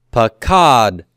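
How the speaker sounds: pakad drawn out, then a released t after the d; pa-KAAD-t, pakad with an extra stop; separate final T sound. Shown as a demonstration. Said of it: "Two silent shavas there".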